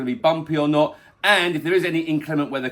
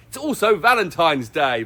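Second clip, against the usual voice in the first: ordinary talk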